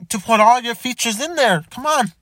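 ordinary delivery

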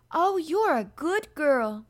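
high, loud, slow delighted cry; crying out gladly. A narrator's reading. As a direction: gush with sudden gratitude. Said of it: "Mary cried".

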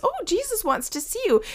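high, bright gushing; excited crowd. Acted. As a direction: gush like a suddenly friendly crowd.

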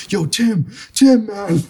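high, rising frightened pleading; panicky friend. Retold or imitated. Imitating his scared friend phoning Tim, high and panicky. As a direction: mimic a spooked buddy calling for help.

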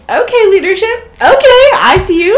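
high, loud approving exclamation; playfully impressed, voice jumping high; impressed reaction. Retold or imitated. Acted impressed reaction to leadership.

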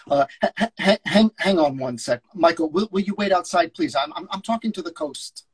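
a hint of a busy New York agent; agent on the phone. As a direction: brush him off mid-call. S0 reads agent George.

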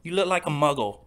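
cheeky Harry Potter parody; mock English accent. Teasing his friend in a mock English accent. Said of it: "our terrible English accents".

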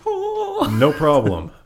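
slow, lightly voiced Dwayne Johnson; a hint of The Rock, drawn out. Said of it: "Is that your rock impression?".

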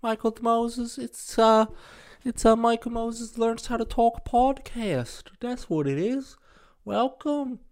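high, put-on English accent; hammy podcast host in a fake British accent. Podcaster greets listeners in a hammed-up English accent. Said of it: "before I try to do accents".

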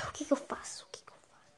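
breathless despairing whisper. Acted. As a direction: whisper it hopelessly.

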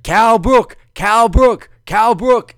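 high, loud dopey repetition of Cal Brook; mock Bernard. Bernard Hopkins mangling the name on commentary, loud mock. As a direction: parrot the wrong name cluelessly. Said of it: "And Bernard's just like".

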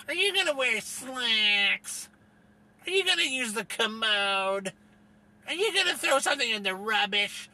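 mocking prissy voice. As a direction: ask it like a prim busybody. Mocking people who say slacks.

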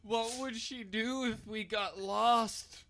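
slow mock-dramatic worry. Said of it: "I was like".

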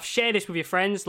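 ordinary talk

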